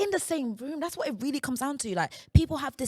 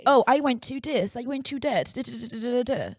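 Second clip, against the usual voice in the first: hammed-up posh English; mock posh boasting; posh accent. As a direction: put on a posh accent and rattle through it. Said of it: "that was my posh accent".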